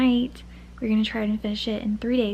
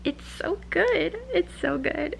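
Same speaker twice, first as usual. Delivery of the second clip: slow, higher it's so good; gushing; 7 semitones higher, slower than usual. Real, not acted. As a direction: swoon over the book. Schwärmt vom Buch.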